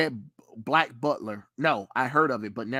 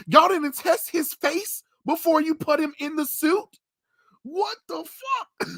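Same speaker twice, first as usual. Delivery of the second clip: incredulous outcry; 11 semitones higher than usual. Retold or imitated. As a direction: shriek your disbelief at the suit. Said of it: "I said".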